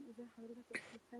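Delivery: far from the mic; faint, distant phone voice. Real, not acted. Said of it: "صوتك بعيد يا [name]".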